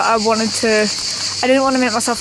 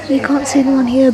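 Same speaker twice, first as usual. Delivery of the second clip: ordinary delivery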